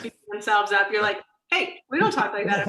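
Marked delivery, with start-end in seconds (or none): 1.5-2.7 louder playful telling-off; acted-out friendly reprimand; mock firm correction. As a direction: call them out, raised and playful. Acting out host correcting a patient.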